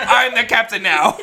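high, loud, laughing movie line; mock captain. Friends joking about the bouncer, laughing mock declaration. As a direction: ham it up through laughter.

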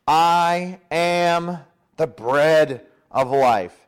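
very slow, word by word; weighty declaration. Acted. Jesus telling the crowd who he is, slow weighty declaration. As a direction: declare it slowly, letting each word stand.

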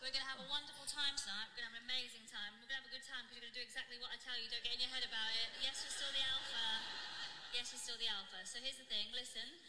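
soft, firm, in-charge lover; calm her partner down, fully in control; confident older woman. Retold or imitated.

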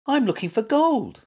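high-pitched wail of protest. A narrator's reading. Little Mutt disappointed by the squirrel's nuts, wailing. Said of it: "he wailed".

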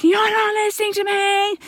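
lightly hammed whine of a grumpy son; whinging child. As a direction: drag it out like a sulky kid. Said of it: "the complaining and the whinging".

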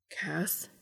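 a gentle breathy whisper of the name; soft whispered call. A narrator's reading. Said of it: "he called softly".

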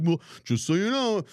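high-pitched know-it-all mock voice; statistic police. Host mocking the statistic police citing perfect games, squeaky know-it-all. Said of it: "the statistic police out there like".

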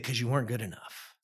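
hushed, understated retold blow; crushing verdict. Coach rejecting the boy to his face, hushed crushing verdict. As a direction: deliver the coach's verdict very softly.

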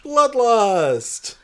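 high, loud mock battle cry; cheering. Real, not acted. Co-host cheering the fight, loud mock battle cry. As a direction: bellow it with relish.

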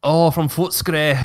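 slow, drawn-out accidental Irish lilt; Irish brogue creeping in, with a laugh; accidental Irish accent. Real, not acted. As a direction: slip into a stretched, Scottish-sounding Irish accent. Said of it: "why I did an Irish accent".

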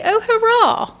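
a reserved aristocrat feigning delight; posh English accent. Retold or imitated. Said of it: "they're very".

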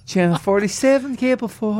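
high, excited, echoing room voice; reverb. Real, not acted. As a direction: call it out gleefully into the echo. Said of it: "I'm sorry for the reverb".

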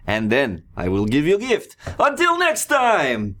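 loud high festive barker call; booming sing song welcome. Acted. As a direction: boom it out like a carnival host. Barker farewell.

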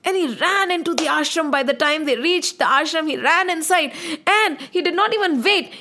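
plain reading voice

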